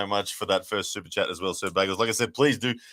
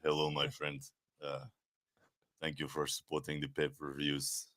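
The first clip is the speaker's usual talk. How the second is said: put-on low, soft, unhurried Anglican accent with long pauses; lightly done Anglican accent, low and hushed; 7 semitones lower, 11 dB quieter than usual. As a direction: murmur a gentle Anglican-accent greeting, much lower and softer. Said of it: "I do the Anglican accent".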